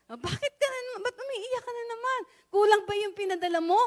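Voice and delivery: high, concerned voice of the boss; employer's puzzled question. Retold or imitated. Employer asking her crying maid why she weeps again.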